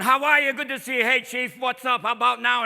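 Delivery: loud cheery Boston cop banter; Boston accent. Retold or imitated. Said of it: "Everyone's doing, like, a Boston accent".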